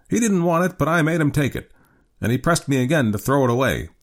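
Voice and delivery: put-on high-pitched old man's voice. A narrator's reading.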